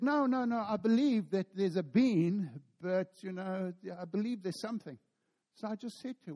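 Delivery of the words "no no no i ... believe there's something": waitress protesting. Retold or imitated. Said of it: "She said".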